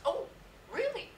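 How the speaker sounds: high, loud imitation of a startled colleague; mock surprise. Colleague learning the teacher is Métis, high startled mimicry. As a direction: mimic her taken aback, pitch shooting up. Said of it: "And she goes".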